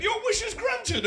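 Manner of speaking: a high, slow little goblin granting a wish. Acted. Goblin granting a wish, high and slow. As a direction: squeak it out like a pleased goblin.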